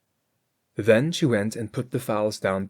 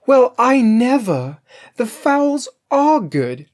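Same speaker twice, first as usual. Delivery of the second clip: higher, louder, slow delighted cry; cried out in delight; 13 semitones higher, 9 dB louder, slower than usual. A narrator's reading. Cook tasting the roasting fowls, a higher, louder, slow delighted cry. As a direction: savour it like a greedy cook. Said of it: "and then cried".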